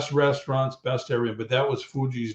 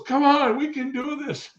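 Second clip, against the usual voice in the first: much higher, a touch of a laugh in the voice; laughing Jake; 14 semitones higher than usual. Retold or imitated. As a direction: imitate Jake chuckling it off, pitched way up. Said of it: "Jake's kinda laughing".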